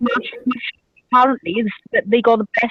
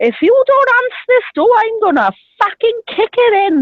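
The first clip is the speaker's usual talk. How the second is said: angry yell; 10 semitones higher than usual. Retold or imitated. Girlfriend banging on the door, imitated furious yelling. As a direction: shriek it at the locked door.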